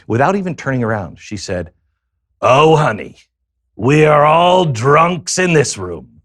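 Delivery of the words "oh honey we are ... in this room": put-on higher, louder, slow worldly old lady; Selma Diamond voice. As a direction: drawl it like a seen-it-all old dame calling him honey. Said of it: "That woman sounded like Selma Diamond".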